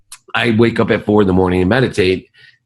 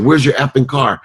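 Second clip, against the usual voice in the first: angry father; 7 semitones higher than usual. Retold or imitated. Imagined angry call to daughter.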